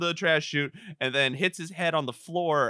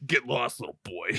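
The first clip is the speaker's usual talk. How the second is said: low, dismissive tough-guy voice; gruff brush-off; 6 semitones lower, 3 dB quieter than usual. Retold or imitated. Hulk shoves the little boy away, in a low dismissive growl. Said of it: "And he goes".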